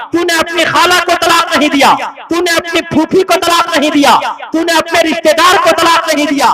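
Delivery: loud, hammering rebuke line after line; scolding shout. Retold or imitated. Cleric scolding the drunk husband for divorcing, loud rebuke. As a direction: berate him with rising force.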